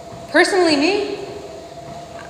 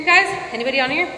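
usual speaking voice

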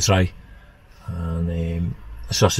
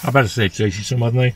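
ordinary delivery

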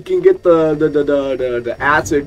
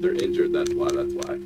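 whispering; 10 dB quieter than usual. Real, not acted. Quiet realization to self.

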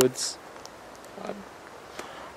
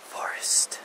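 breathy spooky whisper; 11 dB quieter than usual. Acted. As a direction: whisper it like something is listening. Spooky found footage, hushed.

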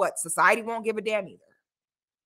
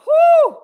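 much higher, loud whoo falling off; excited whoop; 12 dB louder than usual. Real, not acted. Vlogger whooping at the promo video, excited. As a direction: let out a big amazed whoop.

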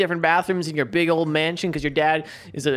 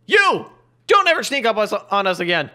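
high, loud, rattled outburst; angry scolding; 3 semitones higher, 5 dB louder than usual. Acted. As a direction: lash out after being startled. Scolds Timmy after the scare.

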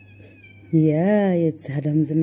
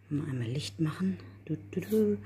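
soft whisper so the cats stay calm; 8 dB quieter than usual. Real, not acted. Whispering to herself beside the cat feeding spot. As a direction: breathe the words, no voice.

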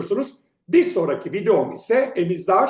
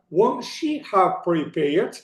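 normal talking voice

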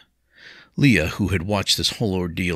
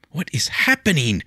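high, louder distressed lady; 10 semitones higher, 4 dB louder than usual. Acted. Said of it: "she demanded of herself".

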